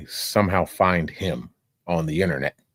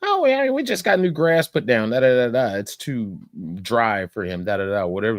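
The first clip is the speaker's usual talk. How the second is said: higher, dismissive mock Karen voice; snooty Karen; 4 semitones higher than usual. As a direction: brush him off like an entitled neighbor. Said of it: "And she's like".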